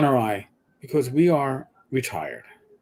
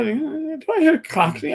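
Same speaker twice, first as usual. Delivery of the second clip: retold surprised customer; 10 semitones higher than usual. Retold customer surprised at hearing accent.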